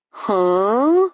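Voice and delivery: put-on slow-witted grunt; dopey voice. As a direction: play dumb on purpose. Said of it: "in the dopey voice he affected".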